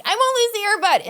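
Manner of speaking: eager promise. Retold or imitated. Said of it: "So I'm like".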